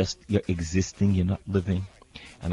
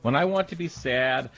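ordinary talk

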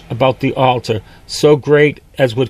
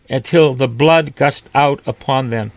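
ordinary talk

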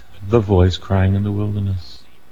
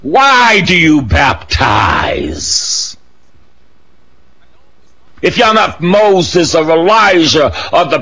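slow, loud demanding question; shouted challenge; 8 semitones higher, 4 dB louder, slower than usual. Acted. Preacher challenging John's questioners, loud and slow. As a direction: throw the question out at them.

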